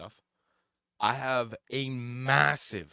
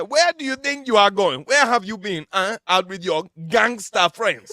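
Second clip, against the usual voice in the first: much higher, much louder Nigerian parent; Nigerian accent; 11 semitones higher, 14 dB louder than usual. Retold or imitated.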